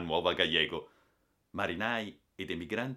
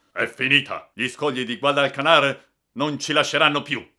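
raised, louder voice of doom; grim loud cry; 7 semitones higher, 12 dB louder than usual. A narrator's reading. Captain calls out in darkness as ship is lost, loud cry. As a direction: call it out loudly over the wreck.

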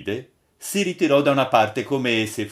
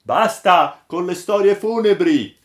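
high, loud crowd protest; exclaimed protest; 9 semitones higher, 7 dB louder than usual. A narrator's reading. Crew objecting to funereal stories, exclaiming. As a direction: cry out as a whole fed-up crew. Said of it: "esclamarono tutti".

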